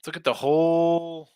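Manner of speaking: "whole" drawn out slowly with lips rounded into a circle; round-mouthed whole. Shown as a demonstration. Host tells co-host to look at the whole picture, round-mouthed and slow. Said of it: "I'm using my mouth to make a circle".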